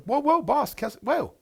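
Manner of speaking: high, alarmed whoa; startled manager. Retold or imitated. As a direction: back off fast, caught off guard. Manager defends himself.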